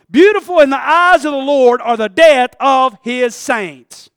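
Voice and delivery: loud scripture proclamation; preaching at full force. Real, not acted. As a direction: proclaim the verse loudly.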